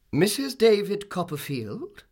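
high, loud, drawn-out question; slow, commanding inquiry; imperious visitor. Acted. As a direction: demand it like a formidable lady.

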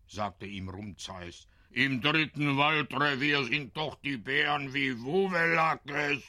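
a father angry at the rash postman; scolding then fretting father. A narrator's reading.

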